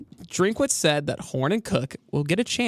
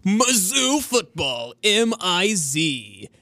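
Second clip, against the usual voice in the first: slow, drawn-out sports promo call; hype announcer voice; much slower than usual. Pretend. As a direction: stretch each word like a stadium announcer. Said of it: "That was really good".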